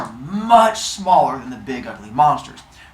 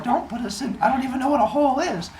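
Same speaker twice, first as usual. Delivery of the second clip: put-on pleading kid; 10 semitones higher, faster than usual.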